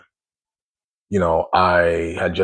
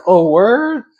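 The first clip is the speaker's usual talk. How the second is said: excited "oh, word?", higher and louder; surprised; 13 semitones higher, 6 dB louder than usual. Retold or imitated. Told he'll meet the girl tonight, excited surprise. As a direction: perk right up. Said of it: "I'm like".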